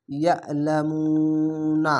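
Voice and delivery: ইয়ালামুনা recited slowly with the মু drawn out one alif; ইয়ালামুনা intoned in Quran recitation style, মু held; recited stretch. Shown as a demonstration. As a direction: chant ইয়ালামুনা slowly, lengthening মু. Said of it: "এক আলিফ টেনে পড়ছি".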